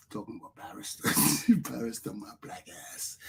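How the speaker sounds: muttered under the breath with scorn; a low dismissive aside; scornful mutter. Real, not acted. Mocking barristers, muttered low and dismissive.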